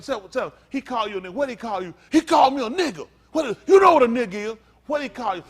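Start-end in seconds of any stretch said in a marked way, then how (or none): 2.1-3.1 loud, high indignant report; hammed-up offended cry; mock outraged complaint. Acting out an aggrieved complainer. 3.3-4.6 loud, fast, high comeback; acted heated retort; mock exasperated shout. Same complainer answering back.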